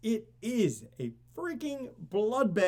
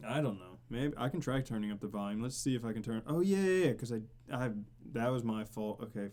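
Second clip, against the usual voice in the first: usual speaking voice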